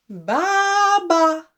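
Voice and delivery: long baa, then a short one; sheep bleat. Shown as a demonstration. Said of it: "The sheep says".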